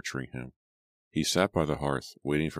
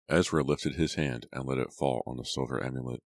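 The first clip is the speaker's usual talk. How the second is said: ordinary delivery, nothing marked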